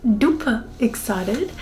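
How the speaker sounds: ordinary talk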